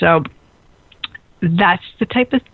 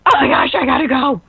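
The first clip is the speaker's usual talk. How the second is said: higher, hammed-up flustered alarm; mock panic; 5 semitones higher, faster than usual. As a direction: re-enact waking up late in a fluster. Retells waking late in a panic.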